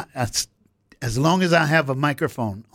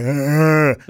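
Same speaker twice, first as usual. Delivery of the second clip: a loud, hammed-up blubbering "ugh"; mock ugly cry; 3 semitones higher, 6 dB louder than usual. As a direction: fake an ugly boy cry, overdone. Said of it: "that ugly boy cry".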